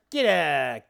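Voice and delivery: put-on broad, playful New York accent. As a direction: shoo them off in mock New York style. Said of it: "do my New York accent".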